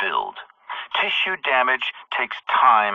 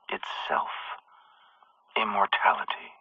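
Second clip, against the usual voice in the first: breathy whisper; 8 dB quieter than usual. Acted. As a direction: drop to a whisper on immortality. Villain reveals ultimate goal.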